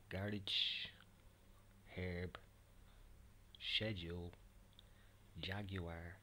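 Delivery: strong Dublin accent, each word drawn out; real Dublin accent. Shown as a demonstration. Whisperer playing somebody else from Dublin, real Dublin accent. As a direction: read the list as a real Dubliner would. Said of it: "with a real Dublin accent".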